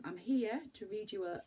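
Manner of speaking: ordinary delivery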